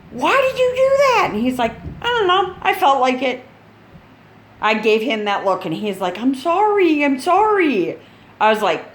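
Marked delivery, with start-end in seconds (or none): 0.1-1.3 very high-pitched, incredulous demand; mock scolding. Mom confronts son about the fight, mock scolding. 2.0-3.4 put-on high-pitched kid's excuse; imitated son. Son answers mom about the fight, lightly imitated. Son's retold defensive answer. 6.2-8.0 high, pleading mock apology; imitated apologetic son. Son apologizes after mom's look, mock pleading.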